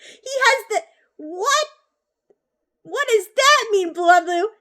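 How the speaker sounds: high-pitched, breaking up with laughter; can barely ask it for laughing; laughing disbelief. Real, not acted. Cracks up at chat's insult.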